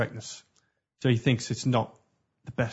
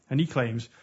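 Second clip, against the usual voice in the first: normal talking voice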